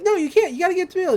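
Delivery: high, urgent mock pleading; acted protest. Mabel objects to uncle's decision, high-pitched pleading.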